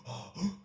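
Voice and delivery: quick unvoiced gasp in through the mouth; snatch a breath through the mouth; sharp mouth gasp. Shown as a demonstration. Coach showing Wim Hof style mouth breathing, a sharp gasp.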